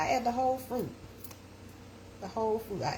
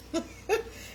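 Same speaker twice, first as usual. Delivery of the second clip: breathy laugh; 14 semitones higher than usual. Real, not acted. Laughing at her own French.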